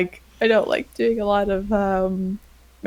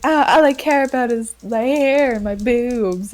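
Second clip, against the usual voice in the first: mocking sexpot voice, pitched up; hammed-up, higher-pitched caricature of a vain woman; 4 semitones higher, 4 dB louder than usual. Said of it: "play the sex pot".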